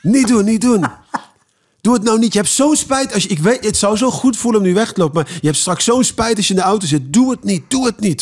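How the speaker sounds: high, urgent self-pleading, half laughing; pleading inner voice. Retold or imitated. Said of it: "In mijn hoofd ben ik het alleen maar aan het doen".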